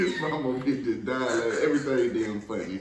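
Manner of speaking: tipsy, laughing through the words. Real, not acted. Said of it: "Maybe 'cause I'm a little tipsy".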